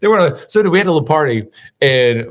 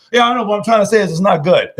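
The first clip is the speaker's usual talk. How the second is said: clearly higher, mock Cajun friend; light Cajun impression pitched up; Cajun accent; 7 semitones higher than usual. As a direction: voice the Cajun buddy, higher and matter-of-fact. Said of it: "And he's like".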